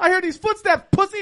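loud, high mocking taunt; taunting yell. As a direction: yell to bait him out. Enemy taunt continues.

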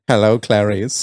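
slow, low, laughing Hannibal line; a hint of creepy Lecter; slowly, lightly voiced creepy killer. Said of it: "turns around and goes".

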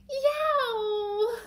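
loud, high "yow"; startled yelp. Acted. Teacher acting the startled moment in the story, loud yelp. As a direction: yelp out in sudden alarm.

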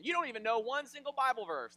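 high, loud taunting accusation; mock challenge. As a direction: needle him loudly. Acting a coworker's taunt.

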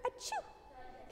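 a tiny, breathy, whispered achoo; soft little unvoiced sneeze. Shown as a demonstration. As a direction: sneeze like a butterfly, barely there. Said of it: "Just a small little sneeze".

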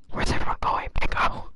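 loud, voiceless stage whisper; Leon whispering. Acted. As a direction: whisper it hard into the mic. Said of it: "that's how Leon whispers to me".

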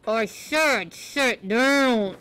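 low grumpy growled order. Shown as a demonstration. Teacher demonstrating a grumpier way to say sit down, low and grumpy. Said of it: "or lower".

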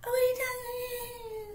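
very high falsetto mock innocence; squeaky, exaggerated playing dumb; blowing it off. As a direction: mimic a mean girl brushing it off. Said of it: "trying to blow it off".

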